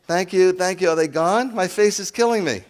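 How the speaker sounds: high, loud, sugary fake-polite voice, then dropping the act; mock a flight attendant's forced cheerfulness; flight attendant impression. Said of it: "like the flight attendant".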